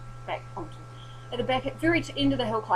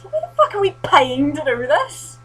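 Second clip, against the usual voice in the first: slow, higher, louder disbelieving grumble; exasperated rant; 8 semitones higher, 8 dB louder than usual. Retold or imitated. To another runner on the hill, a slow exasperated moan. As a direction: moan at the absurdity, dragging it out. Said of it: "I said to someone else".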